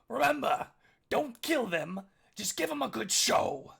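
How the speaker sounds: high, very loud yell; Princess Puddles yelling. Acted. Princess calling to her fighters from behind, loud yell. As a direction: shout instructions over the arena roar. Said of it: "voice of Princess Puddles yell behind you".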